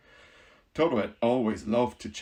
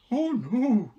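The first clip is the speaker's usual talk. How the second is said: higher, louder firm straw-man voice; Scarecrow declaring; 12 semitones higher, 8 dB louder than usual. Acted. Scarecrow calming the mouse queen, firm and high. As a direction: reassure her firmly. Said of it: "declared the Scarecrow".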